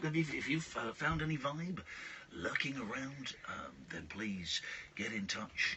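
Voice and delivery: fading to whisper. Real, not acted. Fading low-vibe appeal.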